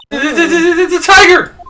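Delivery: high, loud stammering panic; terrified. Acted. Maya panicking as the tiger rushes toward them, high frantic cry. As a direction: stutter in terror as the tiger charges.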